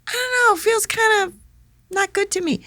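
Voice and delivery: high-pitched mock reluctance; hesitant protest. Actress resisting her director, high and hesitant.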